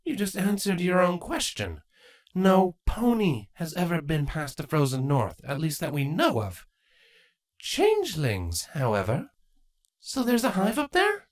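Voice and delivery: lightly voiced queen, strained smile; Queen Chrysalis. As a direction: greet them, hiding anger.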